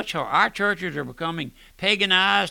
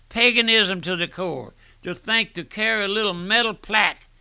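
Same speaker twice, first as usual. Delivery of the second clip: raised, higher, louder preaching against pagan charms; fiery preaching; 5 semitones higher, 4 dB louder than usual. Acted. Preacher to radio listeners, louder heated preaching. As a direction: thunder it from the pulpit.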